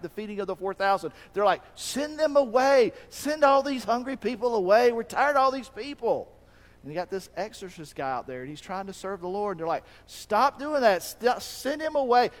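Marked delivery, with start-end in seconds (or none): 1.7-6.3 higher, louder fed-up complaint; exasperated disciples. Acted. Disciples voiced, tired. 10.0-12.3 higher, louder scolding; bossy disciples. Acted. Disciples voiced forbidding.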